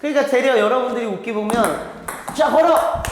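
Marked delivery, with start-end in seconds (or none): 2.4-3.0 brisk loud command, pitched up; coach's call. Shown as a demonstration. Coach calling to a member to hit the drive, loud command. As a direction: bark it like a coach feeding the ball. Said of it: "이렇게 주는 거라니까".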